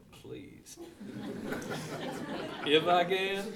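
mock eye-roll scoff breaking into a laugh; sassy Jesus. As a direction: toss it off with a head roll and a laugh. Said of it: "Jesus having, like, a head roll there".

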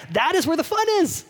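high, rousing call; excited urging. Acted. Calling swimmers out to deep water.

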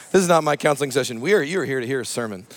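normal talking voice

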